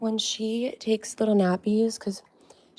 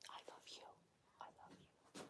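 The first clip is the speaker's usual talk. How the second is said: breathy whisper, much softer; 36 dB quieter, faster than usual. Real, not acted. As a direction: whisper it close to the dog. Whispering to the dog.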